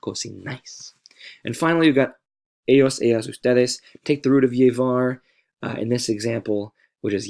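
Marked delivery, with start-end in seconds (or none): 0.0-0.9 cocinais said softly with stress on final "nais"; stress on last syllable. Shown as a demonstration. Teacher pointing out that accented syllable, softly stressing the end of cocinais. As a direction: quietly land cocinais on NAIS. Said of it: "The stress is on that syllable".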